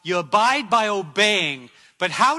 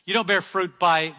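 ordinary talk

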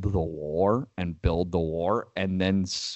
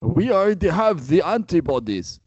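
put-on higher-pitched joking Russian accent; mock Russian accent; 8 semitones higher, 3 dB louder than usual. Mocking Russian hockey players yelling at the league, in a hammed-up Russian accent. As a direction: ham up a Russian accent for a laugh. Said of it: "Is that a good Russian accent?".

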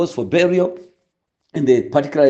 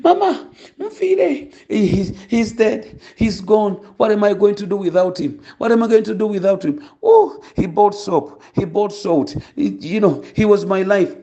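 mock wailing; 6 semitones higher than usual. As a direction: put on a theatrical graveside lament. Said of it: "she begins wailing at the top of her voice".

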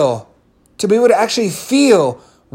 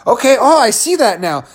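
higher, louder eager realization; acted burst of enthusiasm; pumped up; 6 semitones higher, 4 dB louder than usual. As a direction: react like it suddenly clicks and you're fired up. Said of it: "leave 'em pumped up".

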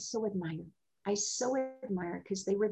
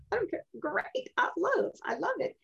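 much higher, breezy and delighted; cheerful carefree. Retold or imitated. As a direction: toss it off happily, a light imitation. Retold cheerful reply when mistaken.

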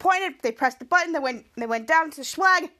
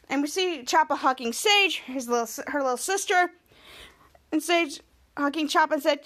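ordinary delivery

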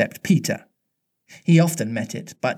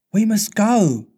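higher, light and bright; almost brightly; 8 semitones higher, slower than usual. A narrator's reading. Wendy on the sinking rock to Peter, almost bright. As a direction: sound almost cheerful despite it all. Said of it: "she said, almost brightly".

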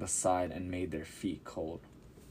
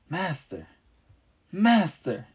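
rising, louder panicked calls of Master; terrified cry; 10 semitones higher, 6 dB louder than usual. A narrator's reading. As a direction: cry out in terror to wake him. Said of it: "the terrified disciples cried".

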